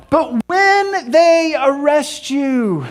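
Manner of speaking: high, drawn-out "when", slowly delivered; stress on "when". Acted. Preacher re-quoting Jesus' warning, "when" stretched high and slow.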